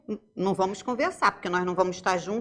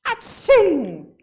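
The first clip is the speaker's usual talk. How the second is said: loud mock sneeze, higher than her voice; 7 semitones higher, 6 dB louder than usual. Teacher imitating a sneezing boy for the class, mock sneeze. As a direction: fake a big sneeze. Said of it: "cena de um menino espirrando".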